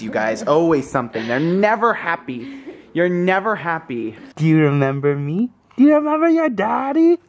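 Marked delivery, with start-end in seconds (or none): 5.8-7.2 sing-song parent voice, pitched up. Real, not acted. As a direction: coo it up high to an infant. Cooing to baby, higher take.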